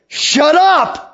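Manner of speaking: high, loud "Shut up!"; fierce shout. Acted. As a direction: yell it with fierce authority. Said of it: "That's how fierce he is".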